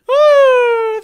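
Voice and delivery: high, loud laughing whoop; shrieking woo. Real, not acted. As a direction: cheer like you just won. Excited cheering.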